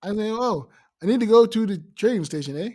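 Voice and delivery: mock Canadian accent with a tacked-on eh; lightly put-on Canadian voice, pitch up; playful Canadian accent impression. Shown as a demonstration. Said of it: "I can just talk like this, eh?".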